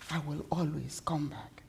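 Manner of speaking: soft, low, whispered vow; whispered promise. Acted.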